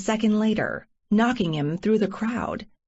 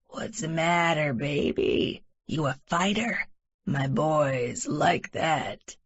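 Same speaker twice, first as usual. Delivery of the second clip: put-on low, slow drunken leer; a lightly voiced drunk creep; rumbling drunk creep; 4 semitones lower, 4 dB quieter than usual. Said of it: "he rumbles".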